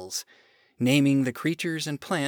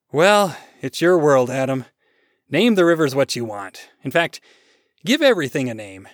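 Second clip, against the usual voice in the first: loud, put-upon God after a sigh; exasperated God; 8 dB louder than usual. Acted. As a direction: hand it over in exasperation. Exasperated God hands over naming.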